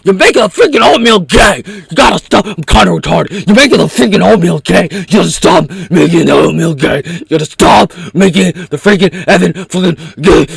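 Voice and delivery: loud ranting yells; mock hysterical rant. Imagined critic ranting at him, loud and hysterical. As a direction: keep yelling in mock outrage.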